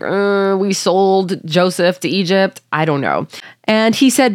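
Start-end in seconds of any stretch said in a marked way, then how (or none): 0.0-2.6 slow, hesitant mock realization of the brothers; lightly voiced guilty sibling; guilty brothers realizing. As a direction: drag it out like a guilty brother catching on. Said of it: "they're all kinda like".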